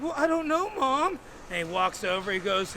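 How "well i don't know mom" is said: put-on high, loud boyish denial; guilty son. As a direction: deny it to mom in a high voice. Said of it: "And he's like".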